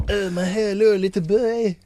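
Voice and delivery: hammed-up, oily French accent; mock French accent. Shown as a demonstration. Showing Jason how it's done, mock French accent. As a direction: greet in a comic creepy French accent. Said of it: "that is how you do a French accent".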